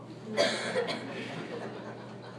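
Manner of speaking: soft breathy chuckle; light amused laugh; quiet laugh. Real, not acted. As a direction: laugh under your breath. Laughing at his story.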